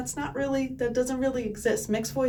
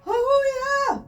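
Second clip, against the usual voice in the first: top note; 13 semitones higher, 5 dB louder than usual. Shown as a demonstration. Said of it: "that's about my top".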